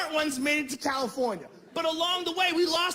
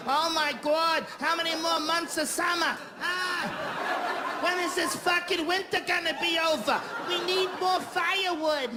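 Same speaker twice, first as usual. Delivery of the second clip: put-on a light Boston accent, higher and whining about winter; 4 semitones higher, slower than usual. Bostonians griping about the endless winter, in a Boston accent. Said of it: "they all talk in the same way".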